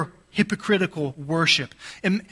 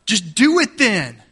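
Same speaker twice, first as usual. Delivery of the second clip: higher, louder mocking go-ahead; sarcastic dare; 6 semitones higher, 5 dB louder than usual. Parent daring kids to eat all the candy, sarcastic. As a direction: push them on with fake permission. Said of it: "You were using sarcasm, right?".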